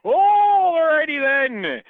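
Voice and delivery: loud, drawn-out high wail; high wailing reaction. Retold or imitated. Retold startled reaction to shocking album.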